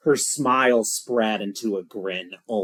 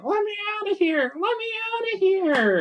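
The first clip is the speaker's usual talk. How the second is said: tiny, very high squeal of a trapped dummy; trapped voice calling. Acted. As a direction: squeal for help in a sustained high pitch. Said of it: "she hears a voice saying".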